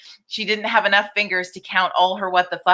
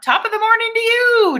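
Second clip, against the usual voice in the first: a hammed-up, much higher stab at an Irish accent, louder; mock Irish accent; 4 dB louder than usual. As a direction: play the cheesy Irish greeting, badly on purpose. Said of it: "I can't do accents".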